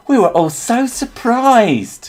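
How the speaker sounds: high, hammed-up astonishment; mock surprise. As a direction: imitate everyone being scandalised, in a high voice. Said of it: "everyone was like".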